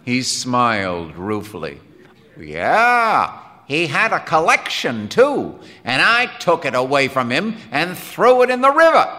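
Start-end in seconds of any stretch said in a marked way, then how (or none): 2.4-9.1 loud, high, emphatic telling; punchy, indignant outburst; indignant Quinn. Acted. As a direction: declare it forcefully, pitch pushed up. Voices Quinn recounting confiscation.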